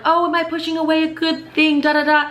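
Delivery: high, mocking whine of self-doubt; mocking her own worry. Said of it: "who's thinking that".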